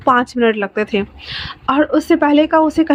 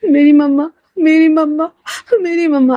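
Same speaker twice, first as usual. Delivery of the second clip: crying; 3 semitones higher than usual. A narrator's reading. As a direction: call for mamma, grief building then easing. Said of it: "wing रोते हुए कहता है".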